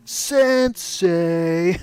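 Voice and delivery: turtle voice. Retold or imitated. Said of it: "the voices of the Teenage Mutant Ninja Turtles".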